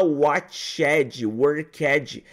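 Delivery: "watched" and "worked" each with a spoken "edji" ending; wrong "ed" pronunciation. Shown as a demonstration. Teacher showing what learners should not say, "worked" with an extra "edji". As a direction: voice the "ed" as its own syllable, "worked" as "work-edji". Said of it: "Você não fala".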